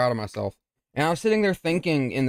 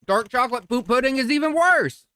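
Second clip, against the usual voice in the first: much higher, mock disgusted; high-pitched; 9 semitones higher than usual. Real, not acted. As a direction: squeal it in disgust. Joke punchline.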